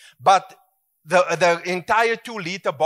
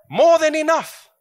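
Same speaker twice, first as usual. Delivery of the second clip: high, ringing exclamation; high, loud joyful cry; excited; 12 semitones higher, 4 dB louder than usual. Acted. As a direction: lift the room with it. Preacher exclaiming abundance.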